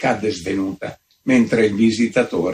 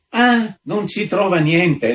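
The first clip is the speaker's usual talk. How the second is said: mock-outraged, higher and drawn out; indignant outcry; 8 semitones higher than usual. A narrator's reading. Visitor flares up at the countess, a hammed-up outraged cry. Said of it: "strepitò l'altro".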